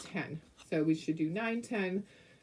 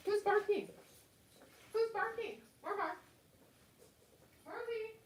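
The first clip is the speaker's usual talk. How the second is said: much higher, softer sing-song pet talk; 9 semitones higher, 11 dB quieter than usual. Real, not acted. Owner coos softly at her barking little dog.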